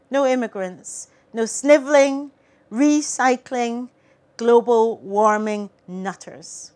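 emphatic, chant-like list of refusals; satirical poem recital. Acted. Poet rails off banned things to audience, punchy declaiming. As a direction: declaim it in punchy bursts, then ease down at the end.

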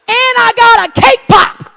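high, loud, drawn-out shout; gleeful confession. Real, not acted. Mom confessing her extra treat, gleeful shout.

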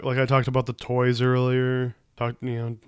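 ordinary talk, nothing marked

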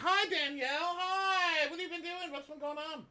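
very high, soft squeal of delight; gushing welcome. Retold or imitated. Housemates greeting Danielle, high soft squeal. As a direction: squeal hello like long-lost friends. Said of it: "You'd think they'd be like".

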